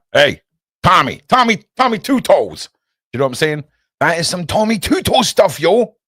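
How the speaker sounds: high and loud wise-guy hollering; mobster shout. Pretend. Loud mob voice, calling out to Tommy Two Toes. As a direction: call out to a mob buddy like a goon.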